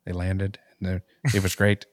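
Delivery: halting low-key report; Elon Musk. Acted. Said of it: "Elon Musk and be like".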